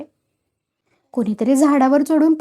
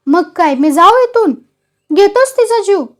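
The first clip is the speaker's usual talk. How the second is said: louder, higher indignant challenge; angry old mother; 8 semitones higher, 6 dB louder than usual. A narrator's reading. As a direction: she flares up at her son. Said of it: "म्हातारी चिडले". (चिडून बोलणे)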